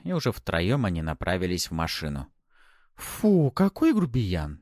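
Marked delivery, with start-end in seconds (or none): 3.0-4.6 high, slow disdainful huff; displeased. A narrator's reading. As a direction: sniff at the rude man. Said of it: "недовольно произнесла Пафи".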